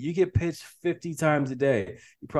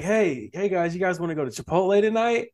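higher-pitched, mock friendly invite; casual pitch to friends; 7 semitones higher than usual. Guest acts out asking friends out to dinner, casual lifted invite. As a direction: float the invitation to the group, voice lifted.

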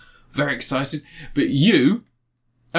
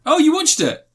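surprised; 4 dB louder than usual. Real, not acted. Surprised reaction.

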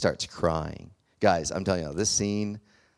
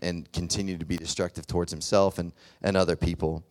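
ordinary talk, nothing marked

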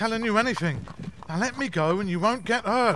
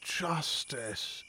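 faint, low last word; dying gasp; 6 semitones lower, 11 dB quieter than usual. Acted. Shot informer giving a name as he dies, faint gasp. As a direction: barely get the word out, very soft.

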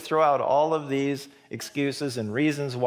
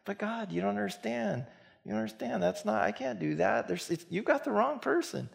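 whiny protest; 9 semitones higher, 4 dB quieter than usual. Retold or imitated. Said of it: "we're just like".

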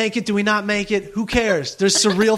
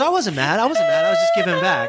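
defensive mock denial; 10 semitones higher, faster than usual. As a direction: protest climbing higher and higher. Acted defensive denial, pitch climbing.

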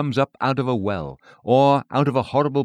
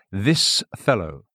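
contempt; 3 semitones lower, slower than usual. Retold or imitated. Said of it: "Thus Christ read their contempt".

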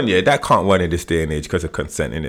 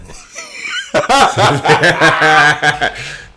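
loud laugh; 7 semitones higher, 3 dB louder than usual. Real, not acted. Laughs at his own joke.

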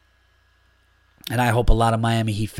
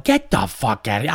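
imitating a friend; 2 semitones lower than usual. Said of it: "he's saying it just like that".

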